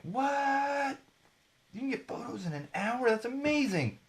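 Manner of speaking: high, hushed squeal of astonishment; mock amazement. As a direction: imitate a crowd losing its mind, voice way up high. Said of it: "everyone just lost their minds".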